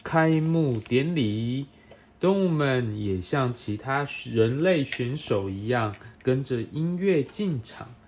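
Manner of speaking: put-on bright, animated read-aloud for a small child; reading to a toddler. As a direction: read it like a bedtime picture book. Parent reading picture book to child.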